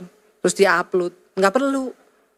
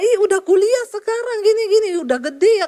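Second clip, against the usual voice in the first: excited greeting; 10 semitones higher than usual. Retold or imitated. Friend's delighted reunion greeting.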